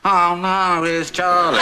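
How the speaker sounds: high, loud imitation of dread; mock dismay. Father mimicking the new reaction to Charlie, mock dismay. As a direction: mimic a dismayed wail, loudly. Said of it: "and now it's".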